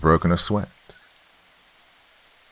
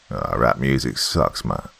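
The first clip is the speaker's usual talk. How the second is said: low, mildly strained dismissal; grunting between reps; 7 semitones lower than usual. A narrator's reading. Soldier rejects rap on the leg press, lightly strained. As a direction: dismiss it while pushing a weight. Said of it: "grunting in between reps".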